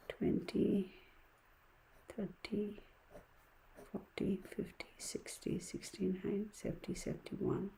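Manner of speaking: counting in a breathless whisper; whispering sums under breath. Real, not acted. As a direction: mouth the numbers to yourself.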